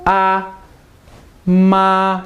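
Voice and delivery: AH and MA held high and level; stretched AH and MA, no pitch movement; first tone. Shown as a demonstration. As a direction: keep AH, MA steady on one high note. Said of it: "your voice just stays steady".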